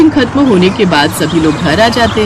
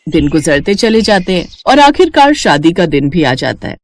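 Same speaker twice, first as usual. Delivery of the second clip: usual speaking voice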